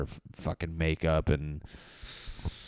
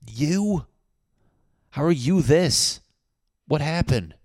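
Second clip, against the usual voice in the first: high, incredulous disbelief; high, loud mock astonishment; caught off guard; 9 semitones higher, 6 dB louder than usual. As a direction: act baffled, pitched up and louder. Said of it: "caught off guard from somebody".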